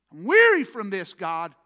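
loud plea that drops away at the end; weary outcry. Retold or imitated. Preacher acting David pleading to God, loud weary outcry. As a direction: cry out, then let the last word fall.

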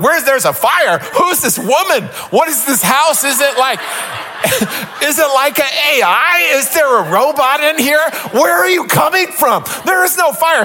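stumbling around at 2 AM, baffled; panicked bewilderment. Retold or imitated. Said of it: "we're like".

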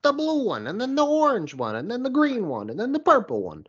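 very high, dazed mother listing pill colours; mocking mom voice. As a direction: squeak it out like the spaced-out mom. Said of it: "And she goes".

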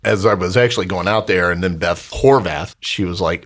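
ordinary delivery

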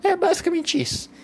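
very high, odd squeaky voice; squeaky comic voice. Acted. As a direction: squeak the wrap-up for a laugh. (voz fininha e bem aguda, de brincadeira)

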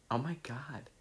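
soft unvoiced whisper of disbelief. Real, not acted. Embarrassed reaction to her old post, whispered. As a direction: breathe it out in shock. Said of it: "reacts under breath".

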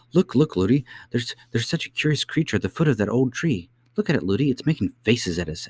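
put-on high, eager little girl's voice; high-pitched child calling attention; chattering princess. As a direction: point it out like an excited child. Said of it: "She was always saying".

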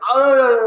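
high, loud drawn-out "oh"; mock rider's scream. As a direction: cry out as on a thrill ride. Imitates rider entering the firehouse.

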